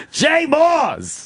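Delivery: high, drawn-out goofy shout; Jay Moore voice. Pretend. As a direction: squeal the name up high. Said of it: "Thank you for, uh, doing that voice".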